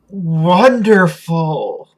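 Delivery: slow, savoured awe; awestruck, lingering delivery; drawn-out wonder. Acted. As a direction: stretch the word out in amazement. Revived character savours being alive.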